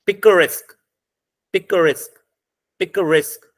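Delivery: "पिकरेस्क" repeated separately, weight on "रेस्क"; stress on last syllable. Shown as a demonstration. Teacher has students try the word, final stress on "पिकरेस्क". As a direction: model "पिकरेस्क" carefully, landing on रेस्क with a falling tune. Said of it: "last पे".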